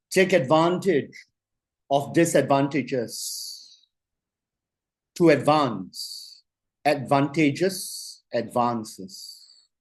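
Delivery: slow, deliberate dictation pace; very slowly. Shown as a demonstration. Coach dictating a motto for the class to write, very slow. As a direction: lay each word down slowly so they can write it. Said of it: "I'm going to say it very slowly".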